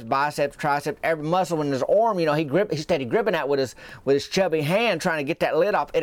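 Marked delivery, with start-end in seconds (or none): none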